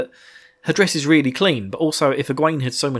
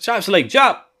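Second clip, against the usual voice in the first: rising, louder snap; exasperated shut up; 7 semitones higher, 5 dB louder, faster than usual. Real, not acted. Podcaster telling Selene to shut up, rising exasperated. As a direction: snap at the scheming character.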